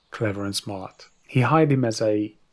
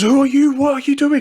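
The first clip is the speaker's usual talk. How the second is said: much higher, louder alarmed shriek; high panicked drunk voice; startled drunk ruler; 9 dB louder than usual. Acted. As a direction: yelp like a drunk king seeing a monster.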